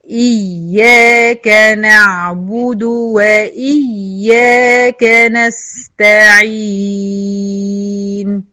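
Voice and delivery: slow, drawn-out chanted recitation; slow Quran recitation. Shown as a demonstration. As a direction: recite it in measured tajweed. تلاوة مجودة. (تلاوة مجودة بطيئة)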